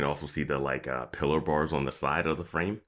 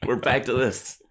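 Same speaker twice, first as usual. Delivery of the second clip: exasperated; 9 semitones higher, 5 dB louder, slower than usual. Retold or imitated. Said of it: "I was like".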